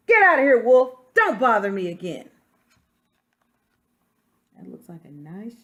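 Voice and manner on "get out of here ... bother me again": much louder, brushing him off; rude shout. Acted. Pig shouting the wolf away from his brick house, rude shout. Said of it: "what that rude little porker answered".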